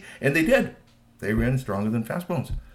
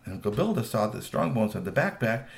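plain reading voice

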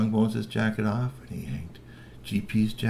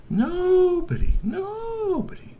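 nobody stretched very high and slow; dramatic drawn out; 3 dB louder, much slower than usual. Acted. Narrator building suspense that nobody watched Bernie, drawn out high. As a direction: drag out nobody, nobody, high and wailing. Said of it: "dramatic drawn-out emphasis".

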